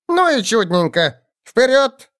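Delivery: high excited goblin chatter. A narrator's reading. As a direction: babble like an eager goblin. Goblin urges on.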